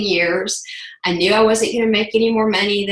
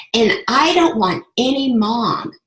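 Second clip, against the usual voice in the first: ordinary talk, nothing marked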